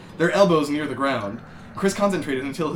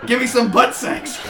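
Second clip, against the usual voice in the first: higher, louder, exaggerated voice; hammed-up plea; 8 semitones higher, 6 dB louder than usual. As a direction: overdo the lusty plea. Rock pleads in scene.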